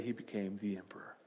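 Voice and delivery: whispered climax; hushed whisper. A narrator's reading. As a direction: breathe the ending out.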